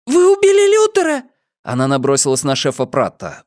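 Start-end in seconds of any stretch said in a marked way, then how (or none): 0.1-1.2 put-on very high, loud girl's scream; shrieking, horrified accusation. A narrator's reading. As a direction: scream it at them in horror. Girl screams accusation at police.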